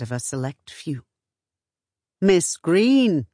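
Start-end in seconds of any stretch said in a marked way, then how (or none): 2.2-3.3 lightly voiced caller with a falling tune; a hint of a gentleman visitor calling; modulated, accented visitor. A narrator's reading. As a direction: call out the name in a measured tone. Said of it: "modulated tone and distinctive accent".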